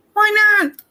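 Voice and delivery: put-on high young girl voice, curious; Dorothy's girl voice. Girl asking about Oz, high and curious.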